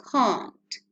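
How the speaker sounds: "can't" with a long open ah vowel; can't with the strong vowel. Shown as a demonstration. Teacher models the contracted form, careful open vowel. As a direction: say "can't" with the broad ah sound. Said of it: "it becomes can't".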